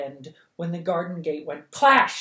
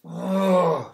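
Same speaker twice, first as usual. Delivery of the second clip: disgusted ugh; 3 semitones higher, 8 dB louder than usual. Acted. Dog settles contentedly in her arms.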